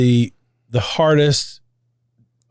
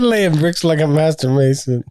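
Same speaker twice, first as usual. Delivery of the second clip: laughing; 5 semitones higher than usual. Real, not acted. Repeating the line while laughing.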